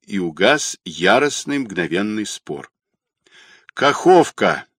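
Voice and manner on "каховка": mild higher, louder call; shouted out. A narrator's reading. Stray baritone calls out the station, shouting. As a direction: call it into the receiver. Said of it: "крикнул в трубку посторонний баритон".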